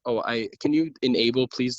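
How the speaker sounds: ordinary talk, nothing marked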